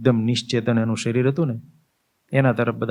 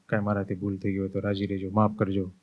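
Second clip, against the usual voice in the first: soft farewell; 3 semitones lower, 6 dB quieter than usual. Acted. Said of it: "બધાને હાથ જોડે".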